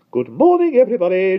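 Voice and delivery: very high, loud and prim; prim lady greeting neighbors; fussy greeting. A narrator's reading. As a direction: fuss over everyone. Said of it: "she greeted them fussily".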